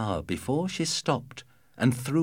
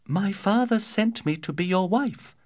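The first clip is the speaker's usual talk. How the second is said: put-on young girl voice; 11 semitones higher than usual. Girl answers giant, high.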